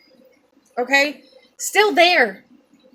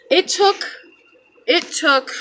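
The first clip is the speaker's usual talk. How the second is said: voice breaking; 6 semitones higher than usual. Real, not acted. Voice breaks recounting the death.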